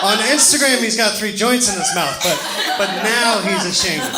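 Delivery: high, loud laughing jab at mom; excited teasing. Real, not acted. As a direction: tease mom across the room, half laughing.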